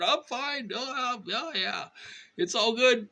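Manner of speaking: very high, forced breezy cheer; fake cheerful reply. Faking cheer to the clerk with a stomach ache. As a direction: cover up the pain brightly. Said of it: "being tortured".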